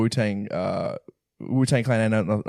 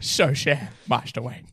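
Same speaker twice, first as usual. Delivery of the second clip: Michael Caine impression; 2 semitones higher, slower than usual. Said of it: "[name]'s Michael Caine reference".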